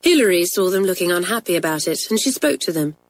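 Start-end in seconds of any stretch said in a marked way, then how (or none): none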